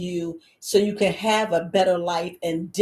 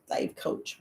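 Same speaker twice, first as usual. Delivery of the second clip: breathy whisper, much softer; whispered aside; 11 dB quieter than usual. Pretend. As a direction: drop to a sneaky whisper. Mocking listener's question.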